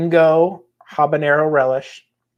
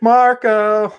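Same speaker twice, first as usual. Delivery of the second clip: calling out; 8 semitones higher, 5 dB louder than usual. Pretend. Marco Polo style call-and-response.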